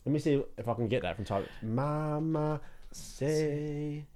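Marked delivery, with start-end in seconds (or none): 1.6-4.1 very slow, drawn-out "Mama say"; soft, long-held "Mama say"; slow sounding-out. Shown as a demonstration. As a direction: stretch each syllable of "Mama say". Trying out the lyric slowly.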